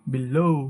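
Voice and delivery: "below" with a long, gliding "loh-oo"; correct pronunciation. Shown as a demonstration. Teacher modelling the correct word, "below" ending in o-u. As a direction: lengthen the second syllable of "below" into oo. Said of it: "সঠিক উচ্চারণ হবে".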